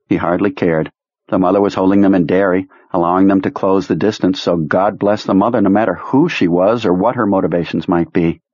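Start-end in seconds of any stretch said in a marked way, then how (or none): none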